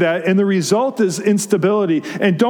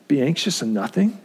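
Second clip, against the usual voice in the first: lower, softer, rising into a question; disbelieving echo; 5 semitones lower, 4 dB quieter than usual. Acted. Overwhelmed objector repeats what Paul says, quietly incredulous. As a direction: echo it as if it can't be true.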